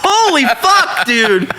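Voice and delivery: slow, high-pitched retold exasperation; exasperated. Bouncer begging the cuffed lady to drop it, exasperated. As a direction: plead in disbelief, stretching the words out. Retells exasperated plea to woman.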